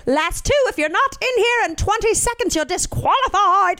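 bossy British lady. Retold or imitated. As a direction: bark the rules like a strict organizer. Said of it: "the British lady, who was very bossy".

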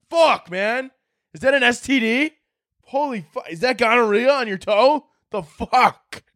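a high, lightly voiced horrified friend; grossed-out friend. Friends at the pool gawking at his toe, mock horror. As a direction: keep the mock revulsion going. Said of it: "They're like".

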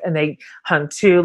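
ordinary talk, nothing marked

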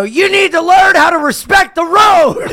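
high, loud righteous outrage; hammed-up indignant holler; retold road-rage shout. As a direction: re-enact yelling at a driver.

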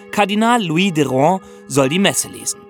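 normal talking voice

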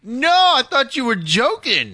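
high, slow disbelief; surprised. Real, not acted. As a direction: say it in shock, high up. Surprised by two-hour show.

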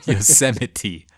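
"Yosemite" slow and loud, yo-SEM-uh-tee with a clear T; correcting pronunciation. Shown as a demonstration. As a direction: spell out the T in "Yosemite" for a friend. Friend models the T after mispronunciation.

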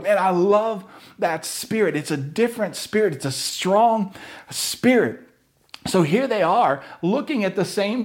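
high, fervent preaching voice. Acted. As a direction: build it up like a revival sermon. Preaching with rising force.

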